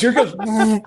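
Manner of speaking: high, loud mock excitement; excited prankster. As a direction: call it out like a thrilling replay. Acting out the prankster's excited showing.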